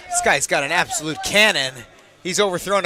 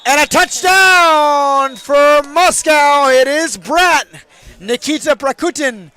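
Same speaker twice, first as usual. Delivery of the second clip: high, loud, stretched touchdown shout; touchdown call; 9 semitones higher, 10 dB louder, slower than usual. Real, not acted. Commentator calling a Moscow touchdown with a shout. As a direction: bellow the touchdown to the crowd.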